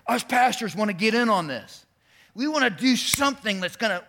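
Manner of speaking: loud, high, eager voice; eager pastors. Retold or imitated. Pastors pitching the city manager, loud and eager. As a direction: hype it up like excited pastors. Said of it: "and they said".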